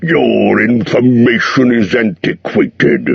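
slow, curt dragon retort; dragon snapping. A narrator's reading. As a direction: cut the hobbit down sharply. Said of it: "he snapped".

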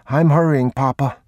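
put-on little boy protesting, pitched high; young boy. A narrator's reading. Boy hurrying after his father, high childlike voice.

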